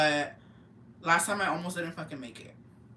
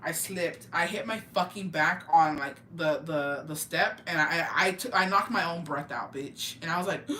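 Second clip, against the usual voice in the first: normal talking voice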